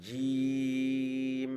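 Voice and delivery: jim and shin held very long; sustained Arabic letter recitation; drawn-out letter names. Shown as a demonstration. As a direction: stretch জিম and শিন out slowly like a tajweed teacher. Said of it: "আচ্ছা".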